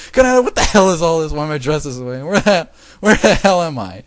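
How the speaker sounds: high, loud indignant rant; angry ranting. A narrator's reading. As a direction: rant in outraged disbelief. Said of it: "Kagami kept ranting".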